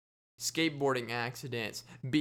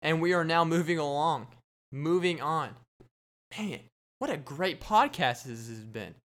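ordinary delivery

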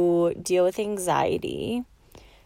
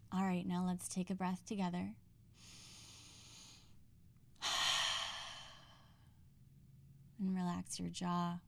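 soft, hushed meditation voice, much quieter than her chat; guided meditation; 19 dB quieter than usual. Acted. Host guiding podcast listeners through a relaxing breath, soft and hushed.

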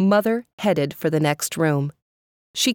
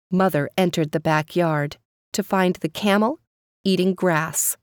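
plain reading voice

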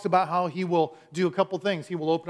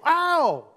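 high sudden yelp of pain; sharp mock cry of pain; comic yelp; 10 semitones higher, 5 dB louder than usual. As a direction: yelp when the wallet is touched. Wallet pressed, comic pain.

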